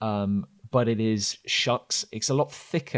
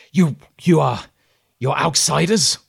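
louder, higher disbelieving question; wary surprise; 4 semitones higher, 6 dB louder than usual. Acted. Hidden voice questioning the travellers, wary and surprised. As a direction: wonder if they are really outsiders. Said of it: "this voice ... goes".

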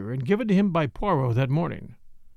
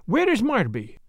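sudden question; 6 semitones higher than usual. Acted. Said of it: "whirled round upon me again".

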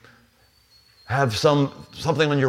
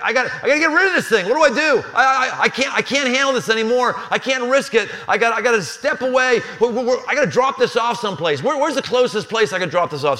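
fast, high, flustered babbling; frantic king who wants the ark gone; panicked David; 8 semitones higher than usual. Retold or imitated. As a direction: rush it out in a higher panic.

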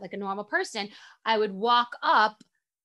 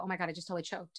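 mortified hush; 5 semitones lower, 13 dB quieter, much faster than usual. Retold or imitated. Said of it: "I go".

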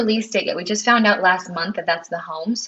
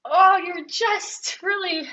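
touched; 9 semitones higher, much slower than usual. Real, not acted. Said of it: "you're gonna make me cry".

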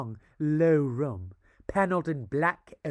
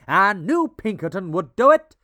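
loud triumphant boast; triumphant exclaim; 4 semitones higher, 9 dB louder than usual. A narrator's reading. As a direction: crow with triumph. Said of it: "he exclaimed triumphantly".

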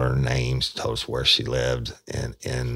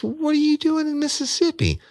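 freaked-out woman. Retold or imitated. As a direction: voice a scared woman confronting him. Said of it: "It freaked me the fuck out".

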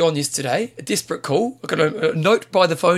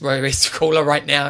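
usual speaking voice

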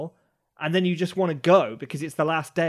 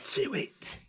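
whispered emperor voice; 3 semitones higher, 5 dB quieter than usual. Pretend. Others copy the emperor voice.

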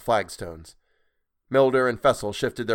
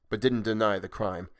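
ordinary delivery, nothing marked

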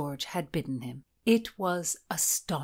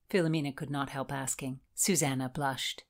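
ordinary delivery

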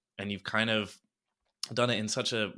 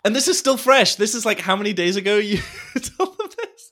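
excited; 8 semitones higher, 8 dB louder than usual. Real, not acted. Excited laughing exchange over news.

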